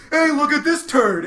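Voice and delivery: high, loud teasing call-out; mocking shout. Comic roasting an audience member, loud mocking shout. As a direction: roast him across the room. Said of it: "I was like".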